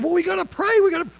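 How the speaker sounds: high, loud insistence on praying; eager, pushy push to pray; excited. Retold or imitated. Friend insisting they pray now, excitedly. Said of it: "But he was all excited".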